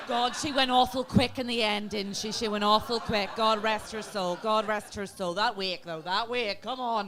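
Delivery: loud, mournful village gossip; Irish auntie gossip. Acted. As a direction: play the old Irish mourner. Mock Irish funeral gossip.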